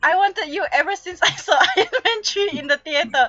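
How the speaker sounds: reads the line while cracking up, high and loud; can barely get the words out for laughing; laughing through quote. Acted. Reading fanfic quote through laughter.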